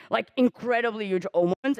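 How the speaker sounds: ordinary talk, nothing marked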